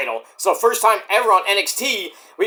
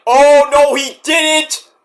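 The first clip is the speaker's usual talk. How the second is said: high, loud scandalized gasp; mock outrage; 7 semitones higher, 6 dB louder, much slower than usual. Fan reacting to Oba's insult, loud and scandalized. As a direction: act scandalized. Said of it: "I was like".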